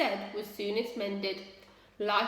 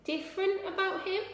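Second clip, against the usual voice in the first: quick question; 7 semitones higher than usual. A narrator's reading. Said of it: "asked Ma quickly".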